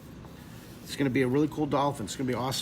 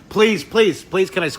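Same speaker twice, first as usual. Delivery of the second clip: higher, louder pleading; eager begging; 5 semitones higher, 7 dB louder than usual. Real, not acted. Begging to squish the hair.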